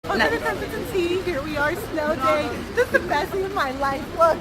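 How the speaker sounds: high, loud, sing-song vlog greeting; big, bright presenter intro; bubbly host voice. Acted. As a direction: greet the fans like a giddy show host.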